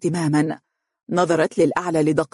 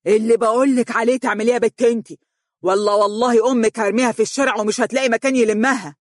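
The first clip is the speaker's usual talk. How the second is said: higher, fast furious threat; booming angry yell; thundering shout; 6 semitones higher, faster than usual. A narrator's reading. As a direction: roar the threat at the girl. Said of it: "صاح بصوت هادر".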